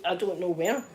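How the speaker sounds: ordinary delivery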